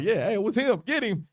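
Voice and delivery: high-pitched snitch calling him out; mock accusation. Women pointing Peter out to the crowd, excited mock accusation.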